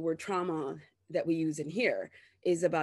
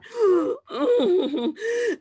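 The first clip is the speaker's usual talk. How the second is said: gasping laugh; 13 semitones higher, 8 dB louder than usual. Real, not acted. Laughing gasp at own fantasy.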